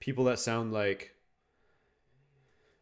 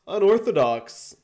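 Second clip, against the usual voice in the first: "Unorthodox" shouted out, much higher and louder; loud exclaim; 8 semitones higher, 8 dB louder than usual. Real, not acted. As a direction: call "Unorthodox" out like a stunned commentator. Commentating own match, repeats word.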